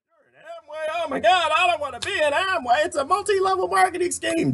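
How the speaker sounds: high mock horror; mocking people. Imitating people who sneer at Amway, high and horrified. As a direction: squeal it like someone scandalized by Amway.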